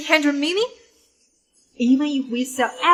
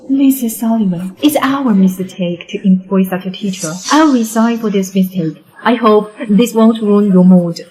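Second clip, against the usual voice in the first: loud apology; 4 semitones lower, 9 dB louder than usual. Acted. Principal apologises to the wife.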